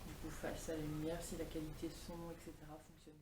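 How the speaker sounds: vocal fry; low, croaky vocal fry; morning voice. Real, not acted. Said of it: "j'ai ma voix du matin".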